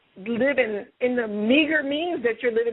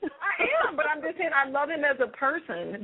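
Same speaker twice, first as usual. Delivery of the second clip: ordinary talk, nothing marked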